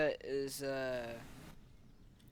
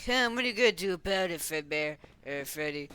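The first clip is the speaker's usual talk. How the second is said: loud, fast mocking taunt, pitched up; 8 dB louder, faster than usual. Kid taunting Fredbear, loud fast mockery.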